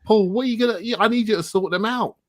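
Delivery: high, loud mock scolding; exasperated telling-off. Real, not acted. Co-host ordering Paul to sort them out, exasperated. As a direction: call Paul to account, voice jumping up.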